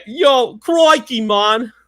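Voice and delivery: high, loud, hammed-up Aussie; attempted Australian accent. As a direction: have a go at a big Australian accent. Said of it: "gotta say it with Australian accent".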